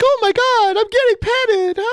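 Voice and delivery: very high, squeaky excited dog; happy dog voice. Pretend. Co-host imagining the dog's thoughts, squeaky high happy dog voice. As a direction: voice the dog thrilled to be petted. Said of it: "I just imagine the happy dog like".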